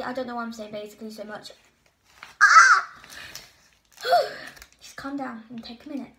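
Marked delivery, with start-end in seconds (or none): 2.4-2.9 high loud squeal of nerves; real squeal; excited shriek. Real, not acted. An excited outburst. 4.0-4.7 a long high sigh to settle down; real shaky sigh. Real, not acted. Calming herself.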